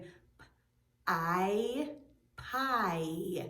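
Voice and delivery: "p-, I" as separate sounds, then blended into a long "pie"; sounded out. Shown as a demonstration. Teacher testing for the I sound, sounding out "p-, I, pie". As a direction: isolate the p, hold the I, then say "pie". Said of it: "Do you hear an I sound".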